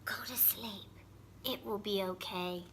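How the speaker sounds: soft, sleepy whisper; sleepy fox whispering goodnight to Little Seed. A narrator's reading. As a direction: hush him to sleep. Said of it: "whispered a sleepy little fox".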